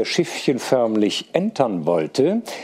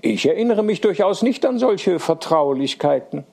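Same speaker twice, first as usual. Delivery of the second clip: higher-pitched, playful indignation; half angry, half laughing; 7 semitones higher than usual. A narrator's reading. Girl denying childhood kisses to her suitor, half angry, half amused. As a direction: protest hotly but only half in earnest. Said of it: "halb zornig, halb lachend".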